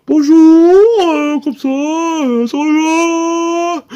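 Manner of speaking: high loud singsong bonjour; high sing-song tweeting voice; singsong chirpy voice. Retold or imitated. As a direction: chirp it like a little finch. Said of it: "Il parlait très prononcé, un peu chantant".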